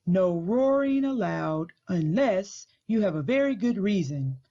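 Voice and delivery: read-aloud picture book voice, unhurried; story narration. Acted. School librarian reading Library Lion aloud to children, gentle storytelling. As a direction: tell the story to a room of kids.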